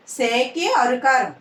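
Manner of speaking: ordinary delivery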